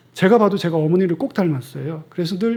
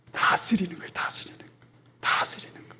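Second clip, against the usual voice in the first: soft whisper, leaning in with the secret; hushed reveal; whispered answer; 10 dB quieter than usual. Real, not acted. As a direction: let the answer sink in, barely voiced.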